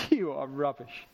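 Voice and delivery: low, dismissive put-on voice; hammed-up contempt, dropped low; mock disdain. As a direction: play a scornful judge writing someone off. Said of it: "So Jesus isn't here tonight thinking".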